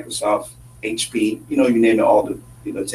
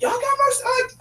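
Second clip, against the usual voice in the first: mocking ignorant Americans. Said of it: "People are like".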